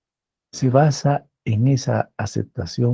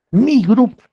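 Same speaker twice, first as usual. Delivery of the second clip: "mi grupo" jumps up high and louder, hitting grupo; emphasis on grupo; 13 semitones higher, 7 dB louder than usual. Real, not acted. Professor stressing to his students, "mi grupo" pitched up high and louder. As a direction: lift "mi grupo" sharply as the point of the sentence. Lecturer stressing key idea.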